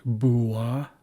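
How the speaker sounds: "bua" with a clear oo before the a; oo sound. Shown as a demonstration. Teacher showing what the W makes, "bua" with oo sound. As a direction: say "bua" as boo-ah. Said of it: "meant to make an oo sound".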